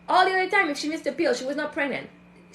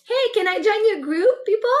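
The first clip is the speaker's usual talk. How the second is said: loud, higher breezy ask; bold group-joiner; 6 semitones higher, 5 dB louder than usual. Acted. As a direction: call out boldly to join in. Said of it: "I'm too shy to".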